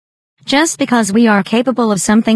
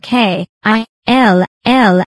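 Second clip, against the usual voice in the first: K I L L spelled slowly, each letter weighted; emphasis on KILL; slower than usual. Shown as a demonstration. Spelling out his surname to the listener, emphasising K I L L. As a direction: lean hard on K I L L, letter by letter. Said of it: "with an emphasis on".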